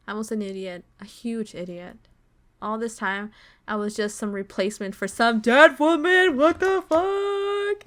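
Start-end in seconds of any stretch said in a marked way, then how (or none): 5.1-7.8 loud outburst climbing much higher, stretched out at the end; can't believe it, voice shooting up loud; indignant disbelief. Real, not acted. Gamer outraged at being a replacement for some dead woman, indignant disbelief. Outraged reaction to story twist.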